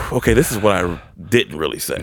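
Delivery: big exhale, then subdued words; deep sigh first. Retold or imitated. Friend mimicking a storyteller's hesitation, sighing. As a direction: imitate someone bracing with a long breath. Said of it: "The way you took that deep breath".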